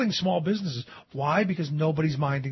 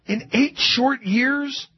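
"eight short years" drawn out slowly and higher; stress on EIGHT SHORT YEARS; 6 semitones higher, much slower than usual. Real, not acted. Host marvels at the banks' damage, "eight short years" slow and emphatic.

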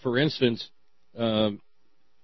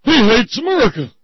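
high, loud jeering yell; mock outraged shout; 11 semitones higher, 10 dB louder than usual. Mocking the responders' accusation, high outraged shout. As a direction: yell it like an indignant detractor. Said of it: "her jugglers responded".

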